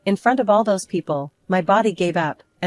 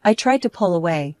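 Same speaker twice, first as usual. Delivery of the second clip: plain reading voice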